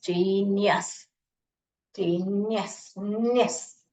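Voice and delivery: जीनियस slowly, the first syllable held, then a light नियस; correct pronunciation. Shown as a demonstration. Teacher shows children how the word reads, slow careful जीनियस. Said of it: "जीनियस नहीं पढ़ने का".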